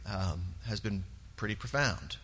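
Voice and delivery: normal talking voice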